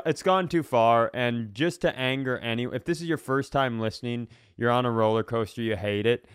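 normal talking voice

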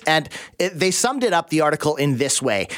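ordinary talk, nothing marked

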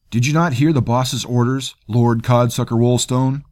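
loud, high sneering taunt; mock him with contempt. A narrator's reading. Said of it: "Maggott sneered".

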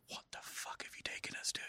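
whispered, hissed in horror; shocked whisper. Retold or imitated. Teacher whispering at student during the play, appalled. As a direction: hiss it at the student in the theater. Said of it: "my teacher's looking at me like".

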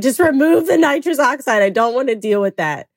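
high-pitched retold plea to stop; half-amused, high-voiced objection; retold protest. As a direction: re-enact begging them off, pitched well up. Retold frantic refusal during labor.

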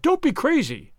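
high, urgent plea; pleading cry. Acted. Wife pleads with husband not to kill, high and urgent. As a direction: beg him to stop. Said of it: "she cried".

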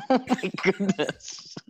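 high, slow laughing exclaim. Real, not acted. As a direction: laugh through the words. Reacts to a fart.

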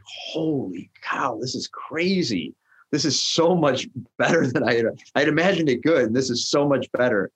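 amazed. Retold or imitated. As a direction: marvel at it building up. Amazed at sold-out tables.

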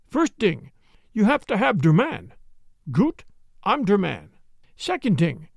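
lightly voiced German-accented Jan, lecturing; a Dutch-German immigrant lecturing the men in a German accent. Jan continues in his accent.

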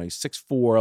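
ordinary delivery

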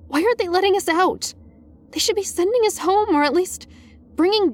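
high-pitched, urgent worry; escalating panic. A narrator's reading. Student to best friend about the teachers, rising panic. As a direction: let the worry spill out in a high voice. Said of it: "my voice was escalating in panic".

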